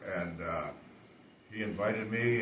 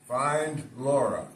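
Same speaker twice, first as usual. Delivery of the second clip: slow, louder "Find Laura."; slow line; 4 semitones higher, 6 dB louder, much slower than usual. Shown as a demonstration. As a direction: deliver "Find Laura." slowly, like a scene. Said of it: "tell the audience your entire script".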